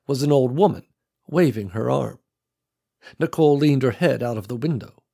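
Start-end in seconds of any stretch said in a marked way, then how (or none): none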